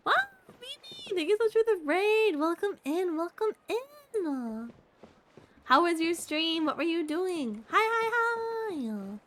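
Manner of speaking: high, bright excited greeting; cute anime streamer. Real, not acted. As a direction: squeal a welcome to the raiders. VTuber greeting raiders excitedly.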